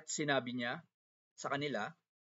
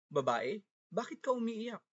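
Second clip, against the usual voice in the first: higher-pitched voice for the angels; angels' question; 8 semitones higher than usual. A narrator's reading. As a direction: ask it high, as an angel in a scripture reading. Angels voiced questioning weeping Maria. (mataas na boses ng anghel na nagtatanong)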